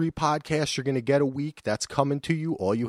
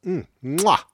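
chef's kiss; 6 semitones higher, 6 dB louder than usual. Acted. Chef's kiss praising the show.

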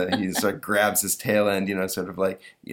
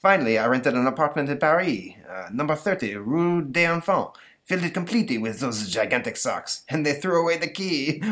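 put-on French accent; 6 semitones higher than usual. Napoleon ends his tale.

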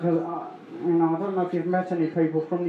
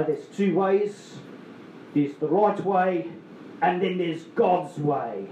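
slow, deliberate quoting of a saying; solemn mock-wise pronouncement; quoting the boss; slower than usual. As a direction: lay out the boss's motto slowly. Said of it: "One of Rory's great sayings was".

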